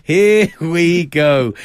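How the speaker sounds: slow, drawn-out here we go; drawn-out teasing. Real, not acted. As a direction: stretch each word to tease. Teasing a co-host's switched pick.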